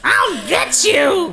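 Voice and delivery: high, slow cackling witch; Wicked Witch. Acted. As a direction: shriek it like a witch. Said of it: "That's a very good Wicked Witch laugh".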